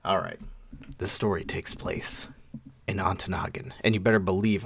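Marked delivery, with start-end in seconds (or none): 1.0-3.7 storytime voice. Shown as a demonstration. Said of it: "I'm gonna do it in my storytelling voice".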